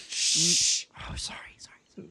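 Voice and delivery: unvoiced hushed apology; whispering. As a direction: whisper an embarrassed sorry. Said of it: "why are we not whispering".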